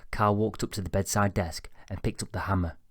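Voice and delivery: ordinary delivery, nothing marked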